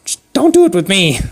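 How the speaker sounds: high-pitched granny warning; mock-stern old lady voice. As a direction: put on a high old woman, warning him off. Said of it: "She's like".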